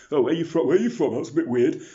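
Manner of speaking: very loud, high-pitched mock questioner; imitating nosy people. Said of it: "And people are like".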